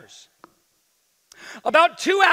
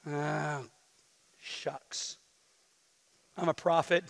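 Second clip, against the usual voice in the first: much softer, half-whispered confession; sheepish; 7 semitones lower, 12 dB quieter, slower than usual. Acted. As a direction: own up under your breath. Jonah sheepishly admits being prophet.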